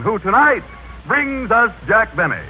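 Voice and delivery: ordinary talk, nothing marked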